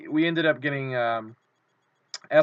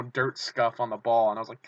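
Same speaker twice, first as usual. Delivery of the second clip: usual speaking voice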